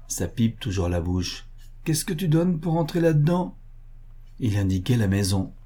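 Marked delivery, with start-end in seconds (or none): 1.8-3.6 higher-pitched, lightly acted demand; bargaining soldier. A narrator's reading. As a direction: haggle as the Cossack would. Cossack bargains for the rescue.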